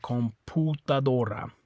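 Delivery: COMPUTADORA with U as POO; Spanish COMPUTADORA, com-poo-ta-DO-ra; Spanish oo sound. Shown as a demonstration. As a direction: say COMPUTADORA with a pure oo, no y-glide. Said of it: "always is an oo sound".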